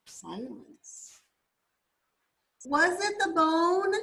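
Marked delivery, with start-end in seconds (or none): 2.6-3.9 high, raised storybook holler. A narrator's reading. Rocket hollers at the bird after more silence, hollering. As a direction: holler the question, pitch climbing. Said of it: "Rocket hollered".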